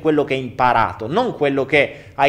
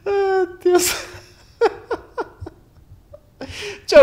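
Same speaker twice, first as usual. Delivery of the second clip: laughing hard; 4 dB louder, slower than usual. Real, not acted. Bursting into laughter.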